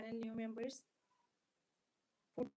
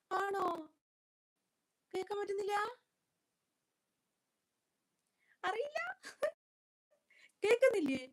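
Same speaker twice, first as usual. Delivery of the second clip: high, raised-voice questioning; real alarmed disbelief; 9 semitones higher, 7 dB louder, faster than usual. Real, not acted. Streamer asking viewers if they can't hear, high and alarmed. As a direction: call out in startled concern.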